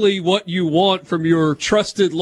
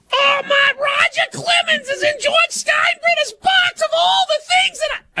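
Susan Waldman impression. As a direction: shriek it like a frantic announcer. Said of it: "when I impersonate Susan Waldman".

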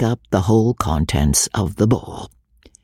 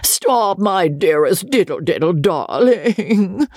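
high, slow tipsy crooning; drunken shout; 6 semitones higher, slower than usual. A narrator's reading. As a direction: drunkenly call after her. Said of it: "shouted Joss".

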